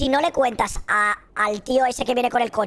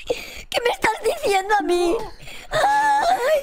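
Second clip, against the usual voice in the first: mock crying shout; 12 semitones higher, slower than usual. Mock crying after sibling-marriage joke.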